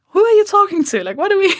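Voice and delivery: high, disbelieving laughing retort; incredulous. Retold or imitated. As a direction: ask in high-pitched disbelief, half laughing. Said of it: "And I was like".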